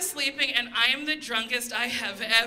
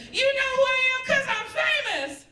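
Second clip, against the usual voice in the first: much higher, shouted celebrity boast; boastful shout; 12 semitones higher, faster than usual. Retold or imitated. Drunk customer answering the Shake Shack cashier, high shouted boast. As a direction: crow it like a diva. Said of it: "I responded with".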